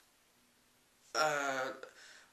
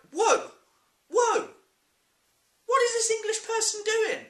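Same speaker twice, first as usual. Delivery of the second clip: high, loud, incredulous outburst; shrieky mock shock; mock bewilderment; 5 dB louder than usual. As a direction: react in exaggerated disbelief. Acted baffled reaction to British actor.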